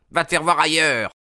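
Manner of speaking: high-pitched, irritated dismissal; irate householder's sharp rebuff; angry rebuff. A narrator's reading. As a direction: send the caller packing. Irate man rebuffing the visitor.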